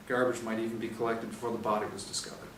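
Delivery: ordinary delivery, nothing marked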